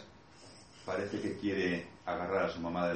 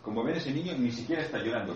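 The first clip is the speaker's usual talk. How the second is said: ordinary talk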